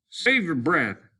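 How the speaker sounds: a hint of a tired lieutenant; lightly voiced officer. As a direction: cut him off curtly. Lieutenant curbs Simmons running.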